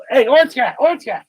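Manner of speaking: loud, high sudden holler; calling to a cat. Real, not acted.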